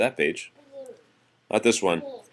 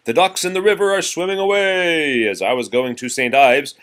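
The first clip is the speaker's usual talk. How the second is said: raised, lively nursery rhyme reading; reading to children; 5 semitones higher, 6 dB louder than usual. Acted. Parent reading nursery rhymes to child, lively. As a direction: read it out brightly for a child.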